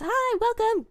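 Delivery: high, bubbly squeal of welcome; delighted high-pitched hello; excited greeting. Real, not acted. Greeting a new viewer.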